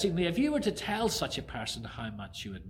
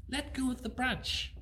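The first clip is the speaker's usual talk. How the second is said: a hint of a higher calm God; lightly voiced God; 10 semitones higher, 4 dB quieter than usual. God tells Jack to let go, calm. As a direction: say it simply and plainly. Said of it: "says God".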